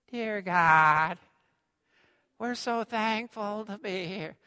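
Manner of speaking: imitating Bob Benson. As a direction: gently mimic a meek praying man. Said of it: "he talked like this".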